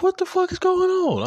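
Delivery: high, loud shocked yelp; shocked outburst. Retold or imitated. Vlogger reacting to the sudden notification, high and shocked. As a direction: yelp it out in disbelief.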